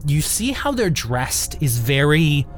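usual speaking voice

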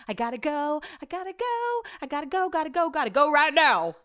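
high, playful gotta go chant; sing-song hurry. Real, not acted. Vlogger singing her hurried goodbye, high and playful. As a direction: chant it like you're rushing out.

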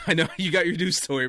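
chuckling as he starts; laughing. Real, not acted. Friend laughing while starting his flood story to the group, amused. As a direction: talk through a laugh.

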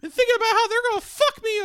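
high, loud, fast squeaky rant; Mickey Mouse coworker. Retold or imitated. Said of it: "sounded like Mickey Mouse".

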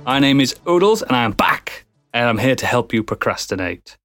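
ordinary talk, nothing marked

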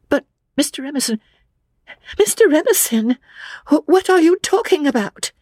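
put-on very high, frightened young woman; high-pitched, stammering bewilderment. A narrator's reading. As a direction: plead for an explanation in rising alarm. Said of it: "Lucy was frightened".

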